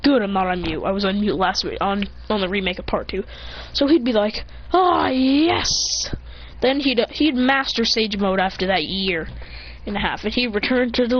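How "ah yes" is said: higher, breath-heavy mock triumph; acted exultation. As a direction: play a small triumphant cry. Character exults at new power.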